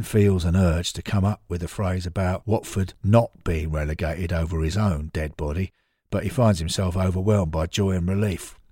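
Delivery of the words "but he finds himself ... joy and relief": ordinary delivery, nothing marked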